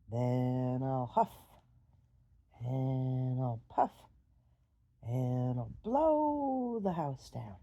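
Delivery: low, loud, slow threat; threatening wolf. Acted. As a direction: draw out each threat menacingly.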